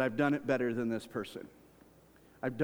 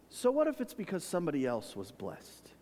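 ordinary talk, nothing marked

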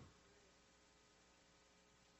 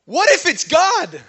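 loud, high, drawn-out exclamation; excited challenge; 11 semitones higher, 10 dB louder, slower than usual. Real, not acted. Preacher challenging a panicking church to see God, excited challenge. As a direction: burst out with the big reveal, slowly.

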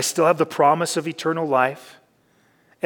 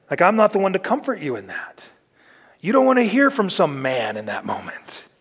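usual speaking voice

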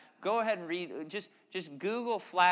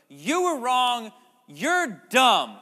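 slow, loud, higher blunt rebuke; mock harsh accusation; 6 semitones higher, 13 dB louder, much slower than usual. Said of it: "They didn't come in and go".

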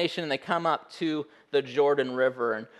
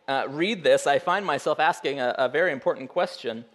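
usual speaking voice

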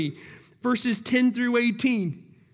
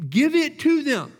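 God giving in to Israel, louder and higher; louder, higher insistent repeat; 7 semitones higher, 4 dB louder than usual. Acted. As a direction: raise it, give it to them. God's reply voiced, repeated.

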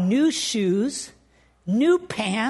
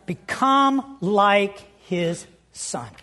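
slow scripture stress; 4 semitones lower, much slower than usual. Acted. Reads key scripture phrase.

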